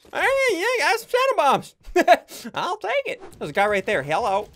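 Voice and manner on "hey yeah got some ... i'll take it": much higher, cartoonish squeak; squeaky silly voice. Gamer finding shadow bombs, in a squeaky silly voice. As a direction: pipe it up like a goofy cartoon kid.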